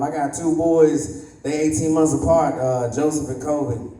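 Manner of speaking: normal talking voice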